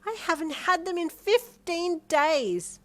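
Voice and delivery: put-on high, loud, drawn-out child voice; excited little girl. A narrator's reading. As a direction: exclaim with a small kid's exaggeration. Excited small child exclaiming.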